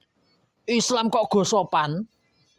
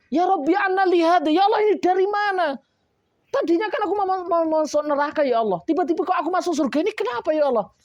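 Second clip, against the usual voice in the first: put-on an old man baffled at his sudden rise to heaven; protesting old parent; 10 semitones higher, faster than usual. The preacher voices an old parent protesting to God, high and amazed. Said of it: "Akhirnya protes itu orang tua".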